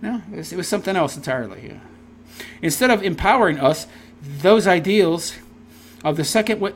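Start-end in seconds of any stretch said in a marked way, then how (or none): none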